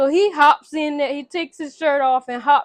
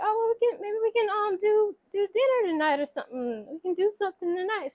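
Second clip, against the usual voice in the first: high, softer imitation of tentative asking; mock hopeful suggestion; 8 semitones higher, 5 dB quieter, faster than usual. Woman suggesting dinner tonight, high tentative mock voice. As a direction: pitch it high and soft, feeling out an invitation.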